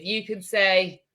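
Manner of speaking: ordinary talk, nothing marked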